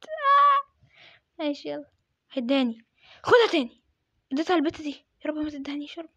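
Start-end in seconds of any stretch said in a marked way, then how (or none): 0.0-0.7 high panicky plea ending in a yelp; rising, frightened whine; scared of dying. Real, not acted. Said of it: "انا خايف جدا اموت". 5.2-6.1 hushed, unvoiced plea; pleading in a whisper; whispered prayer. Real, not acted. Praying not to get the bomb.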